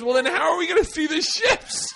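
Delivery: high, laughing, whiny question; clueless voice. Retold or imitated. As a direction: ask it like a baffled tourist. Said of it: "she goes".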